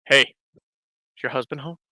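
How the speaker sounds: a lightly faked creepy caller, quietly; disguised prank caller. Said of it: "Honey, I know it's you".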